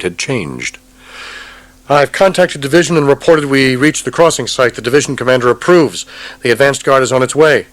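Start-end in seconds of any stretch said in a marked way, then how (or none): 1.9-7.7 higher, louder officer voice; artillery officer. Acted. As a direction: report it briskly.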